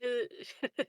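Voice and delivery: normal talking voice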